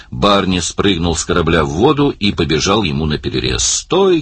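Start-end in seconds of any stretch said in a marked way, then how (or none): 3.6-4.2 high, sharp call to halt; urgent high-pitched order; shouted command. A narrator's reading. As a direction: call out to stop a driver. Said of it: "крикнул он Далласу".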